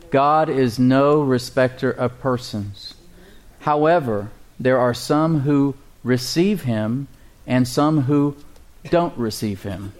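"God is no respecter of persons" read slowly; slow, measured Bible reading; slow scripture reading. Shown as a demonstration. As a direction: read it word by word, deliberate. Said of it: "scripture read slowly".

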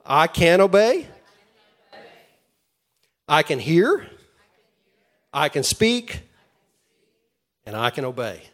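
loud congregational call to repeat; call and response. Shown as a demonstration. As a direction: call it out for them to echo. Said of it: "So say this with me".

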